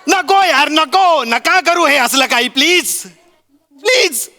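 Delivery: high, loud exasperated outburst; fed-up shouting. Acted. Husband shouting at wife and mother to stop fighting. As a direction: yell it like you've had enough.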